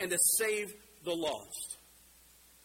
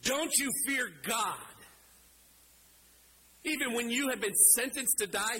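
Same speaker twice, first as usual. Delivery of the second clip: acted yell back; 6 semitones higher than usual. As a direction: call across to scold him, without full volume. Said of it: "he yells back at him".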